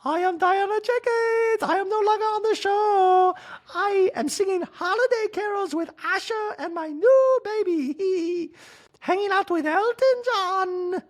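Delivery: very high, loud, strained put-on voice; Diana Jenkins. Ex-cast member bragging about her new life, squeaky high voice. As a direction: squeal it like a grand diva. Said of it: "Remember Diana?".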